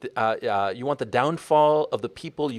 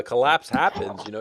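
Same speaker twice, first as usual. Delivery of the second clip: normal talking voice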